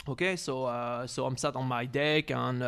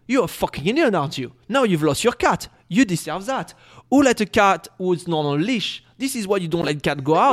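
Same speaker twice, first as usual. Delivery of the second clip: loud, higher scolding imitation; retold insulting; 5 semitones higher, 8 dB louder than usual. Neighbor insulting the owner from his deck, loud scolding. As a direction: hurl it as a mocking telling-off. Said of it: "insulting me from his deck".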